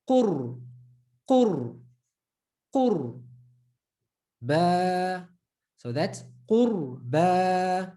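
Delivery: qur repeated, qurba drawn out very slowly; teacher drilling qurba; qur with ra sukoon. Shown as a demonstration. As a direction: build qur then ba. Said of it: "Qu, with the ra sukoon".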